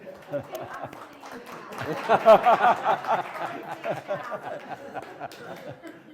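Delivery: loud burst of real laughter; long hearty laugh. Real, not acted. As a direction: laugh hard at your own joke. Joke about wife standing up.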